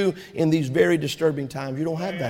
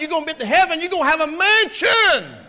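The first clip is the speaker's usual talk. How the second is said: full-force preaching; 7 semitones higher than usual. Acted. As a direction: preach it up to the rafters.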